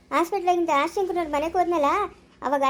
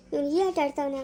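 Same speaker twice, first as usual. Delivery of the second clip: normal talking voice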